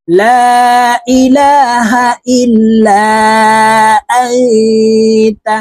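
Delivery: slow chant with লা and ইল্লা held very long; three-alif stretch. Shown as a demonstration. Teacher showing madd lengths, long stretched লা and ইল্লা. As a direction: draw লা and the end of ইল্লা out three beats. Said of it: "এই লাতে তিন আলিফ টান হবে".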